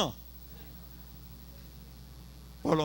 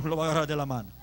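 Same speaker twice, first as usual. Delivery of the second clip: ordinary talk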